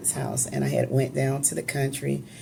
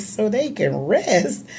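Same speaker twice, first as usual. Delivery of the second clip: retold slow questioning echo of "So they can rest", higher and rising in doubt; puzzled echo; 6 semitones higher, 3 dB louder, slower than usual. Daughter echoing father's words to the room, puzzled. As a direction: repeat "So they can rest" back slowly, baffled. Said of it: "I said".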